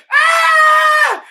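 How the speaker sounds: high sharp yelp of laughter; shrieking burst. Real, not acted. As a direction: let out a high yelp of glee.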